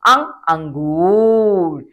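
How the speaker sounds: slow drawn-out अंग, अंगूर with a nasal ng; nasal anusvara demo. Shown as a demonstration. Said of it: "उच्चारण किया था अंगूर में".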